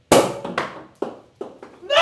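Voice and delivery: sudden high breathy cry; startled cry. Real, not acted. Streamer as the soda sprays out, startled high cry. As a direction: yelp as the bottle bursts.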